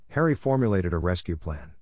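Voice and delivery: ordinary delivery, nothing marked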